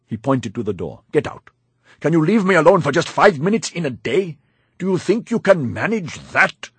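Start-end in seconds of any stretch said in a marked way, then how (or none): 1.1-6.7 high, loud exasperated tirade; angry dismissal. A narrator's reading. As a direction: scold the servant out of the room. Master orders servant out angrily.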